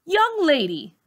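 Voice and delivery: put-on sharp, high call of young lady; teacher snapping at a student; stern teacher. A narrator's reading. Teacher scolding a girl in the hallway, stern call.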